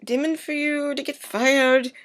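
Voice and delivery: high, gushing apology; mock apologetic gush. Man gushing apology to fired woman, high and overdone. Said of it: "And he's like".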